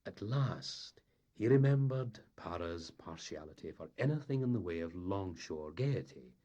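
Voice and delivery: plain reading voice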